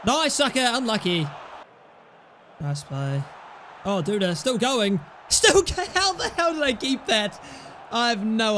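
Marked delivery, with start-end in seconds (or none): none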